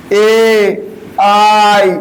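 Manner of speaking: ordinary delivery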